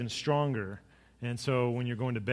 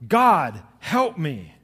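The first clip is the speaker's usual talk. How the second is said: loud desperate cry to God; a man facing a bear cries out to God, loud and desperate; cried out; 6 dB louder, much slower than usual. A narrator's reading. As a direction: call out to God in panic. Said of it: "he cried out".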